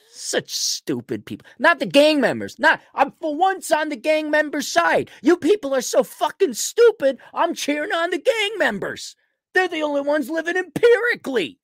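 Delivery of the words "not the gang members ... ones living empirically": high-pitched, incredulous rant; worked-up, high indignant yelling; exasperated outburst. Real, not acted. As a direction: let the exasperation push your voice way up. Real outburst at stupid people.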